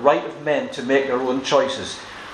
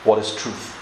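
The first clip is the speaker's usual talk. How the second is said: low, understated weary cynicism; lightly acted world-weary question, pitched down; cynical, weary; 6 semitones lower, 3 dB quieter than usual. Preacher voices Pilate's response to Jesus, mildly weary and cynical. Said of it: "It's a cynical, weary answer of the world".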